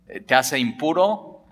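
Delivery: ordinary talk, nothing marked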